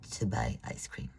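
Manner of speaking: whispered wish. Acted. Little sister whispers what she wants, hushed. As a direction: whisper it like a secret.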